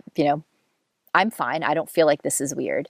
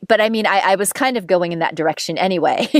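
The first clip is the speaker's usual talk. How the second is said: ordinary delivery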